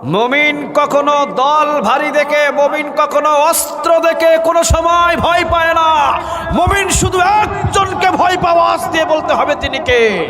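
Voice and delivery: very high, strained sustained shouting chant; full-force preaching. Real, not acted. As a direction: belt it out at the top of your range. (গলা ফাটিয়ে চড়া সুরে ওয়াজ)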